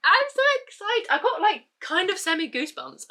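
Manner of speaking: very high, loud giddy squeal; excited squeal. Real, not acted. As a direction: squeal with goosebumps of joy. After reading the blurb.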